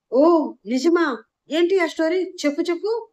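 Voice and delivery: thrilled sister pleading to hear the love story; eager excitement. A narrator's reading. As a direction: beg for the story like a thrilled girl.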